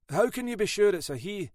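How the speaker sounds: a lightly voiced questioning sergeant. A narrator's reading.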